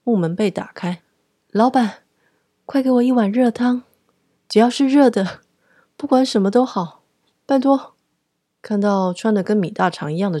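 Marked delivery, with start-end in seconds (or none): 1.5-7.9 high, loud, urgent begging; frozen girl bursting in, begging the boss for hot soup loudly; desperate plea for hot soup. Acted. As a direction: plead at the boss, higher and louder. Freezing customer begs for soup.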